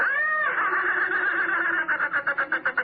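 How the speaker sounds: long eerie giggle from the doll; a creepy toy laughing at her. Acted.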